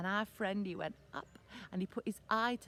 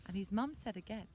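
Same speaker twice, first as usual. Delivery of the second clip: ordinary delivery, nothing marked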